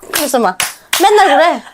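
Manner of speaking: usual speaking voice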